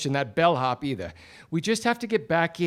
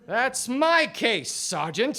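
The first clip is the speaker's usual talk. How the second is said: indignant protest; 7 semitones higher, slower than usual. Acted. Detective claims the case.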